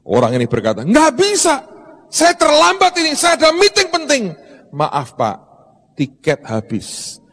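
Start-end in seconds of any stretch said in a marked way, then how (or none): 0.8-4.3 high, pushy, indignant protest; angry rich man. Retold or imitated. Rich old man to ticket clerk, indignant and high. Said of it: "Marah ini orang". 4.7-7.2 a lightly voiced clerk, soft and slow; polite ticket clerk. Ticket clerk to angry rich man, soft and slow.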